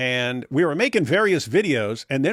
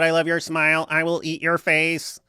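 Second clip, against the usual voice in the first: high stiff robot voice; 4 semitones higher than usual. Pretend. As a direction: read it like a robot. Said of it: "I'll just do a robot".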